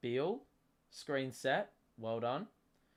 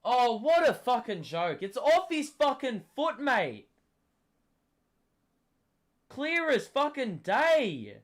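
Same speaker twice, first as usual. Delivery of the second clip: loud, high outraged rant; sideline yelling; 9 semitones higher, 6 dB louder than usual. Real, not acted. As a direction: keep yelling in disbelief. Still raging at the call.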